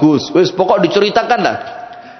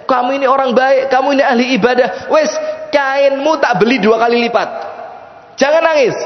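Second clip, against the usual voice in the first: high, insistent reassurance; flustered consoling; 8 semitones higher than usual. Acted. Buyer offers double to stop the tears, flustered consoling. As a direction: talk someone out of crying, pitched high.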